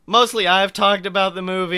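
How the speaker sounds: usual speaking voice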